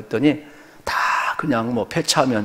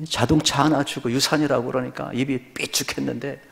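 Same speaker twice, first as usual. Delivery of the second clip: normal talking voice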